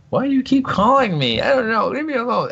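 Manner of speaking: hammed-up high voice begging to be left alone; mock whiny caller-dodger. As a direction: play someone fed up with the phone ringing.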